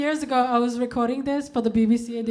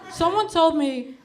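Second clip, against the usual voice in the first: ordinary talk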